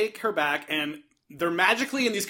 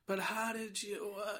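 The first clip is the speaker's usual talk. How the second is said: groggy; 17 dB quieter than usual. Retold or imitated. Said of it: "is kind of out of it".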